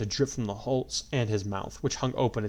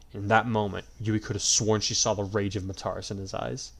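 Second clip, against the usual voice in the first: plain reading voice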